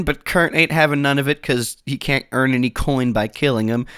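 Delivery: normal talking voice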